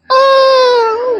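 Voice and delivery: very high drawn-out "oh"; mock high wail. Reacting to the mirror like those videos, mock wail. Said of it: "it's like those real videos".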